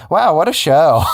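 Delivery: ordinary talk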